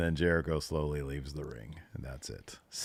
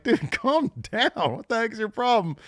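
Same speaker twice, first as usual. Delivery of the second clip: mock Taz; 4 dB louder than usual. Host imitating Taz telling Jericho to calm down, much higher put-on voice.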